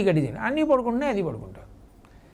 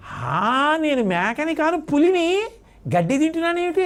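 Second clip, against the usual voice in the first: excited realization; 6 semitones higher than usual. Acted. Cub realizes it's a tiger.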